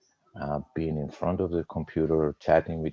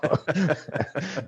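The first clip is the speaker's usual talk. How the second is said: a sudden high burst of laughter; 12 semitones higher than usual. Real, not acted. Guest laughing at his own "rotten head" joke, high laugh.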